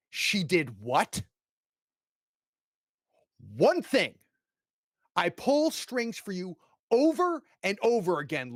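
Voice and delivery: father scolding his son in a rising rage; furious father. Acted. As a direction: lay into him, pulling strings over and over.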